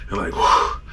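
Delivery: breathless unvoiced exhale; whispered whew. Angler catching his breath after a big fish, whispered whew. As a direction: blow out relief in a whisper.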